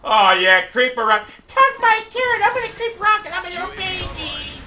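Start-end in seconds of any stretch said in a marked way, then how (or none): 1.5-4.6 high, pinched babyish mimic of the enemy; mock the camper like a scared little baby; mocking whiny voice. Gamer mimicking a timid camping enemy, high babyish mock voice.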